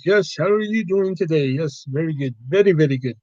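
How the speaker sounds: put-on Indian-accented shop clerk; comic, singsong Indian accent. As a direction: greet customers in a caricatured Indian accent. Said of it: "generic Indian character".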